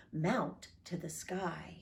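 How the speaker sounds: plain reading voice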